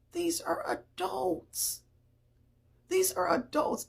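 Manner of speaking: high-pitched, incredulous insistence; strained high, appalled repetition; exasperated disbelief. Real, not acted. Disbelief at adults' behaviour.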